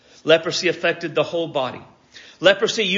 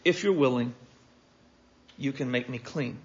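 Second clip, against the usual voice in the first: low, soft plea with pauses; soft, humble appeal falling away; humble request; 3 semitones lower, 7 dB quieter than usual. Acted. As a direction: ask gently, demanding nothing. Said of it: "He just comes and he says".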